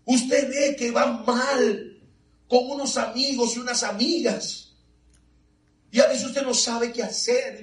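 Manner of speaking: normal talking voice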